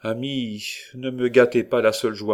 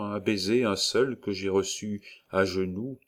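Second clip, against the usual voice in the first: ordinary delivery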